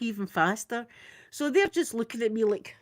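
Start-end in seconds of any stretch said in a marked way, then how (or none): none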